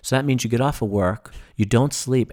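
ordinary talk, nothing marked

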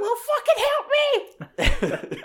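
very high, frantic, hammed-up cry for help; mock panicked yell. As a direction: squeal it like you're being frozen alive. His version of Han's reply.

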